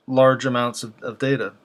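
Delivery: usual speaking voice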